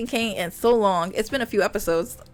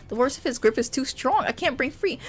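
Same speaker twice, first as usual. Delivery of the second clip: ordinary delivery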